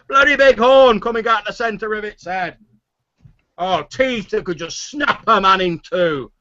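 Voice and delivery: high, loud indignant yarn-spinner; excited sailor's boast, stretched for effect; boastful tavern storyteller. Acted. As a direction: defend the tall tale with rising bluster. Haller defends his tall tale.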